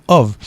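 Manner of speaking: ov ending on a buzzing v; voiced ending demo. Shown as a demonstration. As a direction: say ov with the final v voiced. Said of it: "avec un seul F".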